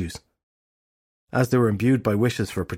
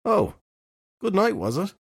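cautious question; 10 semitones higher than usual. A narrator's reading. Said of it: "asked cautiously".

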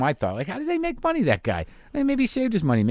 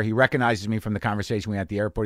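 ordinary delivery

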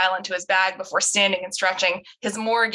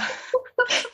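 high giggle. Real, not acted. Laugh after name.

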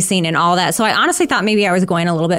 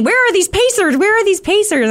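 anxious inner voice; 13 semitones higher, 3 dB louder than usual. Retold or imitated. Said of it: "And I kept thinking".

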